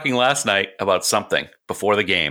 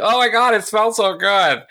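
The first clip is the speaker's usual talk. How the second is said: impersonating sister; 13 semitones higher than usual. Retold or imitated. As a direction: swoon over the smell in a high voice. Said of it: "your impersonation of her".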